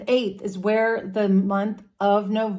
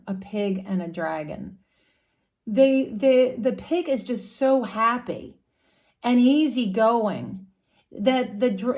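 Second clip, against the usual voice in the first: normal talking voice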